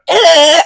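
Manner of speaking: high laughing eh on the tongue; a loud, high 'eh' flicked off the tongue; tongue noise eh. Retold or imitated. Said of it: "on his tongue".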